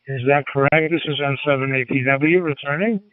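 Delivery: ordinary delivery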